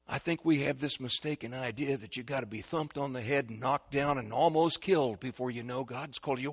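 ordinary talk, nothing marked

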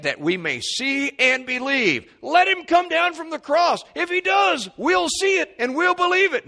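very high, loud jeering; scoffing priests shouting at the cross; mocking shout. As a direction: hurl the dare up at the cross. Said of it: "they continue the mockery".

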